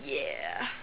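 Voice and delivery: low, soft drawn-out yeah; mock doubtful yeah, dropped low and quiet; retold hesitant reply. Vlogger humouring the doctor's exaggerated plie, doubtful low reply. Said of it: "I was like".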